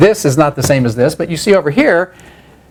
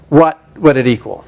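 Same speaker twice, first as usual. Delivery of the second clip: usual speaking voice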